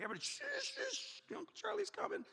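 high, soft hissed warning; hammed-up hush with repeated shh; urgent shushing. Aunt warning everybody that uncle's coming, urgent shushing. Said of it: "Aunt Christy always told us".

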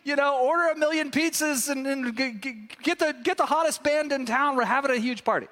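high, excited father calling out the orders; a father beside himself with joy. Acted. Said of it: "he screams out".

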